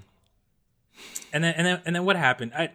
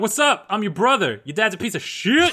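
higher and louder, brash; cocky pretend greeting; 7 semitones higher, 5 dB louder than usual. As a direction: announce yourself boldly to a stranger. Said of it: "Be like".

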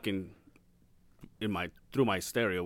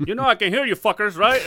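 annoyed boss; 12 semitones higher, 10 dB louder than usual. Retold or imitated. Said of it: "He was like".

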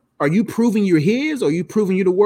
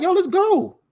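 hyped invitation; 11 semitones higher, slower than usual. Retold or imitated. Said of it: "Jesus like".

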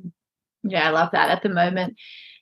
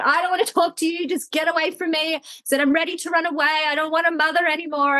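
put-on high, sulky toddler tantrum; like a three-year-old; 8 semitones higher than usual. Mother retelling her tantrum at Kiki, high toddler-like sulking. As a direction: whine like a small child storming off. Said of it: "three-year-old".

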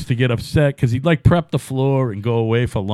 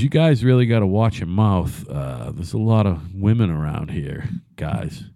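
low, scolding floor boss; stern manager voice; 6 semitones lower than usual. Retold or imitated. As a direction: lower your voice and scold the crew. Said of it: "he goes".

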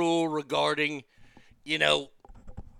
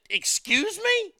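high, slow, offended excuse me; sky-high outraged pitch, drawn out slowly; indignant excuse me; 13 semitones higher, slower than usual. Retold or imitated. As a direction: act insulted, stretch it out. Indignant retort to pushy man.

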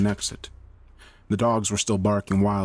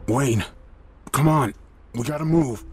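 raised, urgent coaxing; higher, pressing call in short bursts; urging; 5 semitones higher than usual. A narrator's reading. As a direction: rouse a partner to get moving. Man urges unconscious partner to wake.

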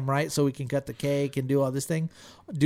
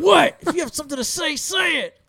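high, loud mock outburst as an angry mom; confrontational challenge; 13 semitones higher, 6 dB louder than usual. Mom catches her kids talking about her, confrontational. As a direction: imitate mom daring someone to speak, pitched way up.

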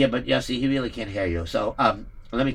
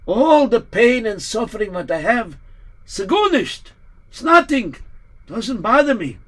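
put-on old rabbi, higher; 5 semitones higher than usual. Rabbi shrugs off suffering.

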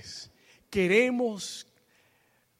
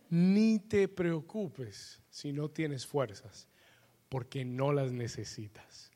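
lento y recalcado; 4 semitones lower, 7 dB quieter, slower than usual. Shown as a demonstration. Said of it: "repeats the words slowly".

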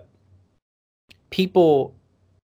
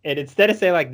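usual speaking voice

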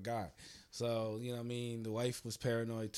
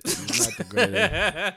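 laughing; 8 semitones higher, 10 dB louder, slower than usual. Real, not acted. Echoing friend's joke, laughing.

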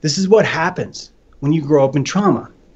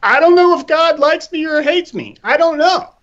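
much higher, bewildered wailing; mock bewildered whine; 13 semitones higher than usual. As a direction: squeak it like a confused bunny.

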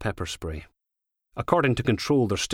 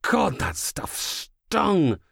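higher, drawn-out cry of pain; pained exclamation; 9 semitones higher, much slower than usual. A narrator's reading. Man hit by pepper spray, pained cry. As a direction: swear through the sting, slowly.

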